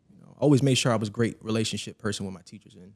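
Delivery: normal talking voice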